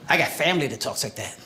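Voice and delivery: normal talking voice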